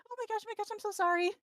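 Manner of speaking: high, quick, apologetic gasping; flustered rabbit girl. Acted. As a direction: babble apologies in a high little voice. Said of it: "Mitch just kinda plays for him, like".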